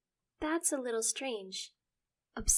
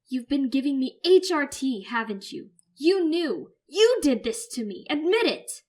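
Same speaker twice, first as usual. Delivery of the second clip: furious accusation; 6 semitones higher, 14 dB louder than usual. Acted. Son accuses mother.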